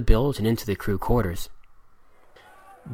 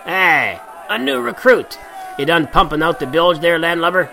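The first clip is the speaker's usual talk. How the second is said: higher, jeering pirate taunt; mocking pirate; 6 semitones higher, 3 dB louder than usual. A narrator's reading. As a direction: tease the new recruit loudly. Pirates mock newcomer.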